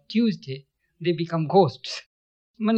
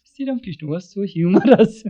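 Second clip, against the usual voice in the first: louder, breaking into a laugh; amused, laughing; 6 semitones higher, 7 dB louder than usual. Real, not acted. Teacher enjoying the master's humour, laughing. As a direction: let the sentence tip into laughter. Amused at his own anecdote.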